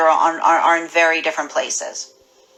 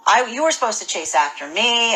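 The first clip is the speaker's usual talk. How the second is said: usual speaking voice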